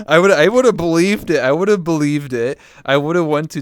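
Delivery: worked-up and emphatic; real outburst. Real, not acted. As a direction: insist on it at full voice. Heated reaction to being teased.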